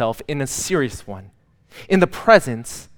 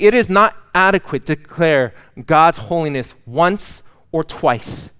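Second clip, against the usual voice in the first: ordinary delivery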